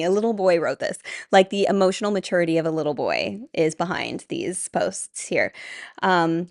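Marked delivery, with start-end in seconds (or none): none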